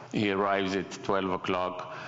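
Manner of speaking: normal talking voice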